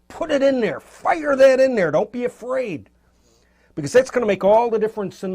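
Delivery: fired-up urging. Real, not acted. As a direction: push them to go for it, full of energy. Teacher urging bold brushwork.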